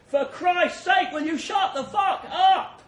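slow, high, loud yell of exasperation; exasperated shout. Acted. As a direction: scream it over the guns. Soldier shouts during firefight.

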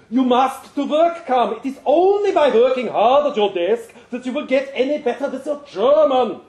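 put-on the pushy boss in heavy German accent; thick German accent. German boss on the phone demanding Thomas come in, heavy German accent. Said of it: "thick German accent".

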